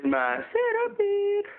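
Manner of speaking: very high, slow silly voice. Pretend. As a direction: squeak it out slowly like a cartoon. Pet name in raised voice.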